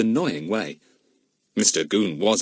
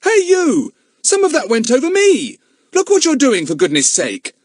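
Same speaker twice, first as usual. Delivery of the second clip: put-on loud, much higher boy's shout; 6 dB louder than usual. A narrator's reading. Larry shouting at the boy who soaked him, loud. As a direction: yell at the careless boy. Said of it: "He shouted at the boy".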